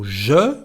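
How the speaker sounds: "je" with a soft French J; "je" begun with a soft zh sound. Shown as a demonstration. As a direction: soften the J at the start of "je". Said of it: "with a soft J".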